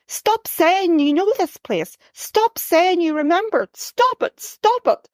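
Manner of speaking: high, pleading shout at her daughter; mother shouting at her tearful daughter, stop it. A narrator's reading. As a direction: cry it out, begging her to stop. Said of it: "She shouts until she sees".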